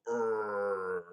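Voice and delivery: loud, drawn-out "urgh" groan; mock troll growl. Streamer mimicking a raging troll, mock growl. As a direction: put on a worked-up groan. Imitates a worked-up troll noise.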